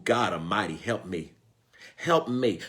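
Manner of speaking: ordinary talk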